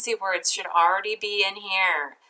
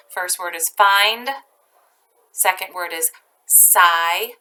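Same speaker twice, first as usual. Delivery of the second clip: ordinary talk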